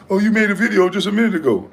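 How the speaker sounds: quick, high-pitched mimicry of an excuse; mocking imitation. Host mimicking opponent's excuse about a video, quick high mocking voice. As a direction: toss out his reply in a fast, high put-on voice.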